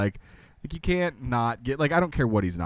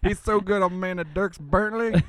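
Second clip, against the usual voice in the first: mock mumbling Southern drawl; 9 semitones higher, 3 dB louder than usual. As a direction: ham up a lazy Texas mumble, names blurred. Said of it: "I love talking like this".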